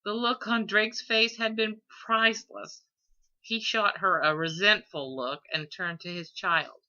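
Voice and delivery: usual speaking voice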